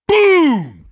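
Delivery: high, loud impact sound; booming sound effect. Retold or imitated. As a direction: slam out the kick. Kicking the ball.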